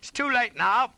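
high, loud rebuttal; urgent yell. A narrator's reading. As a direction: shout him down. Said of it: "I yelled".

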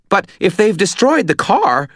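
high, loud, fretful little guy; high-strung, panicky character voice; anxious Butters. A narrator's reading. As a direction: worry aloud in a high-pitched voice.

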